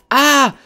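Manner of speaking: loud, high "Ah!"; sudden bright burst of surprise; startled cry. Real, not acted. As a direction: cry out at a discovery. Sudden cry at game discovery.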